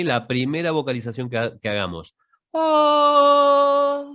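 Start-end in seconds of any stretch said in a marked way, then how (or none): 2.5-4.0 long high ah until the air runs out; vocal warm-up tone; high sustained vocalise. Shown as a demonstration. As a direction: sing ah high and hold. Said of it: "cuando se me va yendo el aire".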